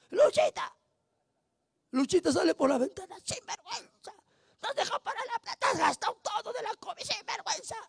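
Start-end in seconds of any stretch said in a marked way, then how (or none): 0.1-0.7 Luchita called out much higher; calling out. Retold or imitated. Calling the drunk's wife at the door. 3.2-7.8 high, soft voice of a scolding wife; wife scolding her drunk husband from the window, high-pitched. Retold or imitated.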